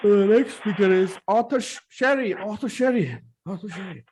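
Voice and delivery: high, slow stage-announcer call; mock announcer. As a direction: announce the next speaker slowly. Mock anchor announcing speaker.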